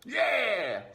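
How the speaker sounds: high, loud squeaky rat cry; greedy rat yelling; rat shouting. Acted. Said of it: "shouted another".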